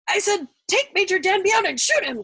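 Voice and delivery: high, loud impatient snap; snapped. A narrator's reading. Colonel snapping the order at his lieutenant, high and loud. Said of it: "Colonel Cathcart snapped".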